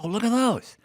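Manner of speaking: imitating a wife thrilled by shoes; mock excited exclamation; delighted wife. Wife excited over sneakers.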